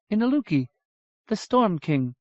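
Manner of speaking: lightly voiced solemn elf lady; sorrowful elf woman. Aditu names the Storm King.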